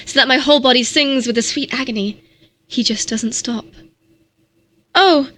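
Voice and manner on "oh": high, loud breathless plea; begging. A narrator's reading. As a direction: beg him, voice climbing high. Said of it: "I beg".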